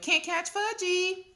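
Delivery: put-on high, drawn-out toddler teasing; hollered taunt. A narrator's reading. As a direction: tease like a runaway little boy, very high. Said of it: "he hollered".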